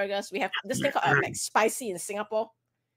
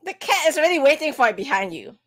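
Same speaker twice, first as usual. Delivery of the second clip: raised, urgent warning; loud; 5 semitones higher, 8 dB louder than usual. Real, not acted. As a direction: call out the cat behind them. Calling out warning.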